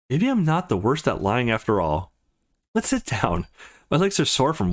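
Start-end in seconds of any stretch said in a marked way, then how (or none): none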